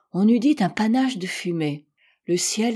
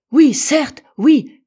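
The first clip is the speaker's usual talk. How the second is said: high, much louder agreeing cry; eager shout; 7 semitones higher, 11 dB louder, much slower than usual. A narrator's reading. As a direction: burst out in agreement. Johnson eagerly confirms land sighted. (cri d'approbation fort et aigu)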